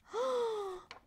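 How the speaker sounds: high surprised gasp. Acted. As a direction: gasp in delight. Storyteller gasps at dyed turkey.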